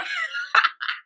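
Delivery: high-pitched burst of laughter; quick amused cackle; real laugh. Real, not acted. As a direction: laugh at your own bit. Laughs at own impression.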